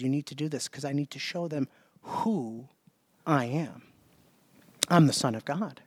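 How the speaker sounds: low, measured voice, lightly acted; solemn Jesus. Jesus tells John who he really is, low and solemn.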